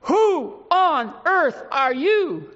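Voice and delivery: slow, ringing challenge; fervent exhortation. Real, not acted. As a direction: press the question hard at the congregation. Preacher's rising exhortation.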